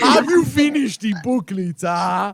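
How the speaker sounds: slow, loud mock African accent, teasing; imitating an African accent. Friend mimics the teacher's scolding in a mock African accent. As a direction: drag the words out in a joking African accent. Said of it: "she went African mid-sentence".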